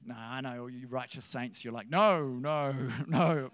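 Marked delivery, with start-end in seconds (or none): 1.9-3.5 drawn-out, hammed-up noes with a laugh breaking in; mock shocked disgust. Preacher teasing the righteous saints, mock shock. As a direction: play the scandalised saint, teasingly. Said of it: "You're shocked and disgusted".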